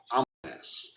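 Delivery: breathless self-assured hush; smug whisper. Acted. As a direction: whisper it smugly.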